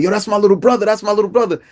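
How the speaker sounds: high, insistent announcement to a crowd; urgent claiming. Retold or imitated. Neighbor tells everybody the wounded boy is his, urgent claiming. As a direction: imitate a protective friend, pitched high.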